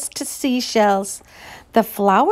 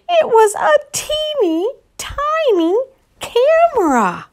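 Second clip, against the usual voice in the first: amazed delight; 13 semitones higher than usual. A narrator's reading. Marvelling at tiny camera.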